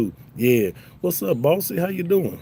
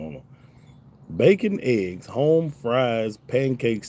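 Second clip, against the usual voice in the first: ordinary talk, nothing marked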